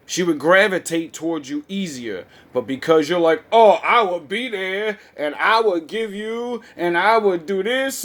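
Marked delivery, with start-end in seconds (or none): 3.5-8.0 mock eager nice guy. Mocking eager beta promises.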